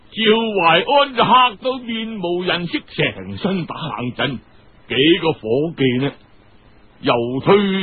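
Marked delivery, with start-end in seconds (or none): none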